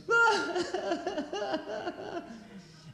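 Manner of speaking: high, breathless laugh; real high-pitched laugh; high laughter. Real, not acted. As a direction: laugh helplessly at the memory. Laughing while retelling.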